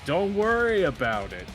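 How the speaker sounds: loud, high, drawn-out mock reassurance; imitating evasive friend. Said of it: "stuff, he goes".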